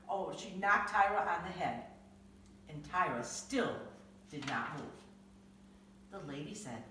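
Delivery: ordinary delivery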